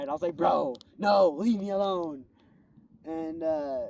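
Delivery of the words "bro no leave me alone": loud, pleading push-back; exasperated protest. Retold or imitated. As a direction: fend it off loudly, mock-annoyed. Arguing back at his own body.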